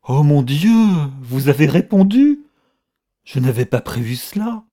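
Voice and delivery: loud, high cry of shock; appalled realisation, voice leaping up; horrified dismay. A narrator's reading. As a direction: cry out aghast, then ease off a little. Horrified at learning of the reply.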